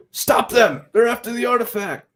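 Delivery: high, loud, badly faked German accent; attempted German accent. A German soldier raises the alarm, in a badly faked German accent. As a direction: shout in a poor mock German accent. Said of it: "I can't do a German accent".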